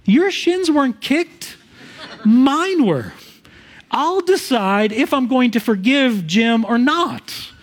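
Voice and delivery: mock outrage at the wrong person forgiving; indignant Jeff. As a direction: Jeff protesting, half laughing. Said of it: "Jeff looks at me and says".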